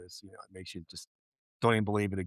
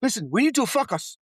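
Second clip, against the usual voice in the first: higher, firm and insistent; raised voice, all business; stern call to order; 11 semitones higher, 4 dB louder, faster than usual. Retold or imitated. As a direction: snap the meeting back to work. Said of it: "He's like".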